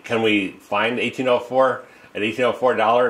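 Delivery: ordinary talk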